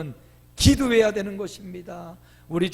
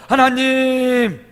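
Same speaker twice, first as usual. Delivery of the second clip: very slow, higher, louder; drawn-out call to God; 5 semitones higher, 9 dB louder, much slower than usual. Shown as a demonstration. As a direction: stretch the word out as a heartfelt call. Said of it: "따라합니다".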